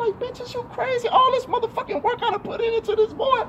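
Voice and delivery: very high-pitched, incredulous scolding; mad rant. Acted. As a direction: squeal the outrage at an absent co-parent. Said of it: "I'm saying it like that 'cause I'm mad".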